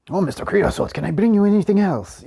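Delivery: high, fawning waiter voice; overly polite restaurant server; obsequious waiter. Retold or imitated. Waiter in Monty Python sketch.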